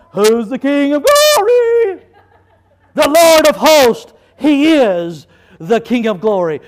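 preaching. Acted. Preaching call and answer.

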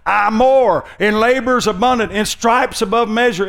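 high, loud mock bragging; fool's boasting. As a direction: boast like a fool at full preaching pitch. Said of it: "Here's how the fools talk".

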